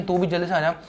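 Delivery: ordinary talk, nothing marked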